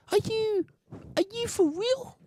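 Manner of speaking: can't believe what he's hearing; disbelief. Real, not acted. Reacting to the woman's video in high disbelief.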